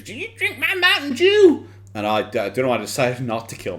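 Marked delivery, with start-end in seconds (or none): 0.0-1.7 loud, very high screamed catchphrase; high-pitched hammed-up yell; mock scream. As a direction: imitate a man screaming, pitch shooting up. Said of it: "where a fat man screams".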